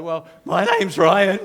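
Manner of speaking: loud, slow, squeaky puberty voice break; mock teenage voice crack. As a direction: let the voice crack up high mid-introduction. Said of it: "my voice just kinda had these moments".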